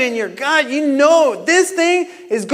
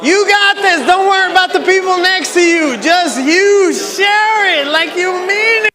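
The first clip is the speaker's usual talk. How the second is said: louder, higher urging over the noise; loud encouragement; 5 semitones higher, 4 dB louder than usual. Real, not acted. Coach cheering on the crowd mid-exercise. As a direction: keep them going.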